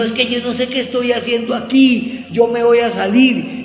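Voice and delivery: réplica imitada, aguda y angustiada; gritos desesperados imitados. Retold or imitated. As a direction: protestar a voces, imitando su desesperación. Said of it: "Y gritaba desesperadamente". (desesperación actuada en tono alto)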